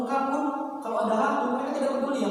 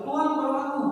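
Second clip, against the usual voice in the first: Peter crying out; 8 semitones higher, 6 dB louder than usual. Acted. Peter cries out sinking.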